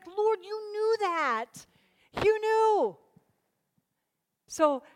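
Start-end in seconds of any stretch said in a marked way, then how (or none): 0.1-3.0 high, drawn-out cry of realization; acted awe, pitched way up; amazed exclamation to God. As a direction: exclaim it high, stretching the words. Acted delighted prayer to God.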